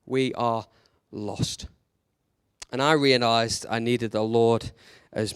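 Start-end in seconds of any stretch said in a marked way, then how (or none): 0.0-1.7 lost dropped much softer and lower; quiet emphasis on lost. Shown as a demonstration. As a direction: let the word lost fall away to near silence. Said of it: "I hope we know that".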